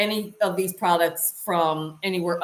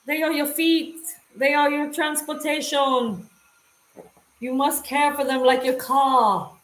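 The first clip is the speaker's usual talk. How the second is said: mock stern lecturer; 7 semitones higher than usual. To viewers, scolding them to care for their boots like a stern preacher. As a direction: lay down the law in a high, comic voice.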